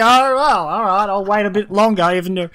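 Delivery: high, loud mocking whine; sneering mimic of a driver; whiny driver.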